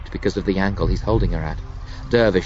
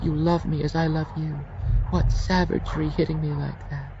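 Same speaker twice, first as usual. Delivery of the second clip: high, sweet murmured seductress voice; murmuring Junie; 9 semitones higher than usual. Acted. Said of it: "she murmurs into his throat".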